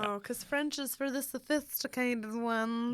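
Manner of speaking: a light, slow put-on snooty tone; snob voice. Mocking French snobbery for the podcast, lightly snooty voice. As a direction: look down your nose at everyone, only lightly. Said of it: "that was my snob voice".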